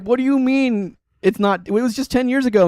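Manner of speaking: high-pitched incredulous protest; acted-out indignant disbelief; mock disbelief. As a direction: protest like you can't accept it. Acting out millennial disbelief about time.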